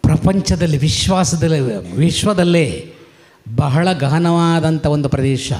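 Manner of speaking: ordinary delivery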